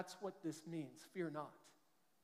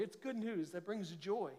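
ordinary talk, nothing marked

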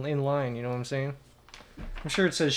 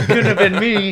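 laughing mockery; 4 semitones higher, 9 dB louder than usual. Mocking friend's excuse.

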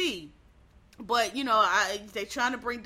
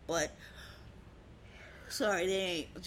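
words stretched by a yawn; 6 dB quieter than usual. Real, not acted. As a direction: talk through a tired yawn. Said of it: "Sorry, I'm yawning".

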